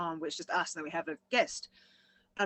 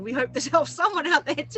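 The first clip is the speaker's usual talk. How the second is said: higher, louder, said through laughter; laughing; 8 semitones higher, 8 dB louder than usual. Retold or imitated. Hosts joking about whether the show helps, laughing. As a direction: say it laughing out loud. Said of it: "laughing, saying".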